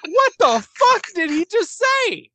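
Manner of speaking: as if the words made no sense; baffled disbelief. Retold or imitated. Hosts confused by the accent, high baffled squeal. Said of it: "I'm like".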